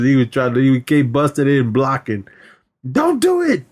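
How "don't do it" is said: high, urgent little plea; mock high plea. Retelling Day Day bursting in, high mock plea. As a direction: cut him off in a squeak.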